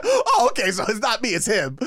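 high, loud, laughing realization; surprised, laughing; relieved surprise. Retold or imitated. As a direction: blurt out the relief. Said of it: "I was like".

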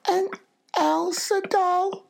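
put-on very high, slow child voice; drawn-out squeaky little-girl voice. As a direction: imitate a tiny girl making her wish. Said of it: "she was like".

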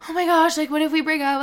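high, loud mock panic over a breakup; a hammed-up burst of anxiety; mock panicked worry. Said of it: "and I'm like".

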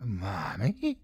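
soft, small questioning whisper; hushed little girl; frightened child's whisper. A narrator's reading. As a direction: whisper it with a timid rising end. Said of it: "she whispered".

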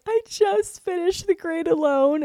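very high, drawn-out tearful squeal; mock crying. Daughter telling mom she finished the book, mock crying. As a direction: wail it slowly, imitating sobs. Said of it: "I can't stop crying".

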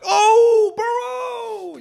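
high, loud cheering cry; excited touchdown shout. Pretend. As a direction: yell it like a stadium call. Imagined touchdown call, crowd cry.

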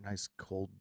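very soft, low aside to himself; hushed craving. Retold or imitated. Recovering drinker imagines a cold beer, hushed craving. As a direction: sink into a quiet, longing murmur.

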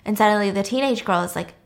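ordinary delivery

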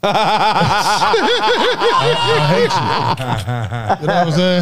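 high-pitched, laughing through the words. Retold or imitated. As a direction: squeal it out while cracking up. Retold quote from angry girlfriend.

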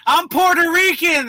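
high, loud mock protest; pleading protest. Caught sneaking in, protesting to border guards, high loud plea. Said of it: "And you'll be like".

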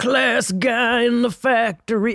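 slow mock blue-collar holler. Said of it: "Like, [name] is still in the".

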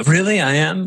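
an eager employee who can't believe it; surprised disbelief. Retold or imitated. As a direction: voice jumps up, amazed and flattered. Surprised new CEO being inspired.

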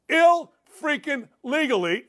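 "Ill-freaking-legally" broken into slow, punched pieces; emphatic repeat. Shown as a demonstration. Fed-up host repeating it for ladies and gentlemen, "Ill-freaking-legally" slowly hammered. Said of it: "I'm gonna say it again".

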